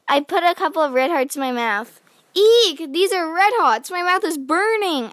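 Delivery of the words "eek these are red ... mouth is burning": the candy burns her tongue; yelp at hot candy. A narrator's reading. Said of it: "I yelled".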